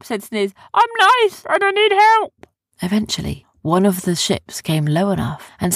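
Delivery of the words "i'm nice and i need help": very high, loud little character voice; high-pitched innocent yelling; pleading creature. Acted. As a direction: call out desperately for help. Sniz calls up to the ships.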